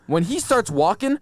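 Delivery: normal talking voice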